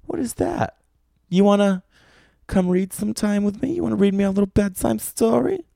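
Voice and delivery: high, coy, teasing come-on; flirty pickup line. Acted. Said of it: "you could walk up".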